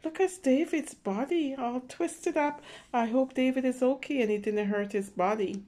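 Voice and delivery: usual speaking voice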